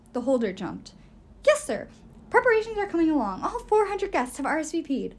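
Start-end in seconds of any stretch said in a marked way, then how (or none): 1.5-5.1 high, loud and fast nervous maid; a jittery housekeeper's squeak; flustered servant. Acted. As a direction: rush it out, eager to please the boss. Inge's hurried report.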